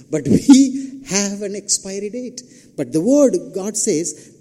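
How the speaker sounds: high, loud, stretched-out sermon delivery; impassioned preaching. Acted. Preacher telling the congregation the word endures, impassioned preaching. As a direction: preach it high and loud, drawing out key words.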